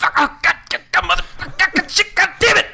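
screaming high and loud curses; wild mock Gosling yelling through laughter; Gosling impression. Doing a Gosling bit for his cohost, screaming curses. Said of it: "That's so loud".

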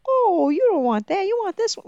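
high, insistent stall seller; a hint of a pushy vendor. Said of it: "somebody's gonna be like".

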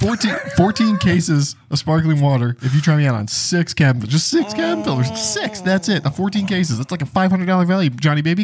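high-pitched wheedling hard sell; pushy sales rep. Pretend. Sales rep promising cases of water for an order, pushy wheedling. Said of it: "perfect impression of exactly what these people are".

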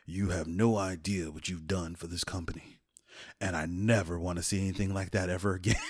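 low, hushed, grave retelling; Vince McMahon. Retold or imitated. Company manager privately addressing the wrestler afterward, low and grave. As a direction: drop down soft and solemn. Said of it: "the manager of the company, Vince McMahon, comes up to me".

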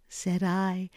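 ordinary delivery, nothing marked

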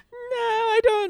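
very high, drawn-out mock protest; high-pitched self-parody; mock naive self. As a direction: put on a slow falsetto, playing clueless. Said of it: "Here I am being like".

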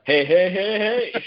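slow, drawn-out sing-song hey hey; imagined cheerful greeting. Pretend. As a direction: call out slowly and playfully to a celebrity. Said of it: "and you're like".

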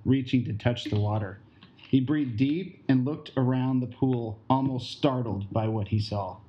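measured audio-drama narrator; story narration. A narrator's reading. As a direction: set the scene evenly for listeners.

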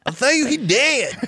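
high, hammed-up Southern swamp voice; laughing parody of a thick Southern accent. Imitating movie's swamp people for co-hosts, thick Southern accent. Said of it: "almost like swamp people Southern accent".

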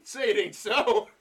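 sarcastic mock dismay, slowed down; mock disbelief. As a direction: ham up the fake shock.

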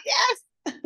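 very high squeal of triumph; celebrating the client's success; triumphant yes. Retold or imitated. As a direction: cheer a high, delighted yes. Receiving the banana text.

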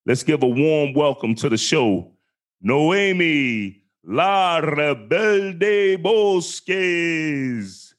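booming ring announcer, long drawn-out name; fighting game announcer. Acted. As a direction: stretch the name like a boxing announcer. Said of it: "You like my announcer voice?".

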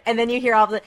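ordinary delivery

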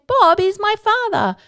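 very high, slow, drawn-out put-on voice; mock-solemn revelation. Crouching to tell children a secret, hammed-up revelation. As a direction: stretch it out high like a dramatic reveal. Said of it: "and I say".

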